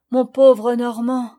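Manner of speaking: loud, slow cry of loss; grieving exclamation. A narrator's reading. Father alone with his son, crying out his loss loudly. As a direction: let the grief burst out, slowly. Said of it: "s'écria mon père".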